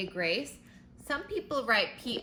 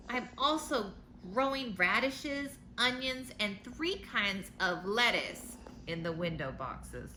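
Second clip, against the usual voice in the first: storybook letter read aloud; reading to children; 4 semitones higher than usual. A narrator's reading. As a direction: read it like a bedtime picture book.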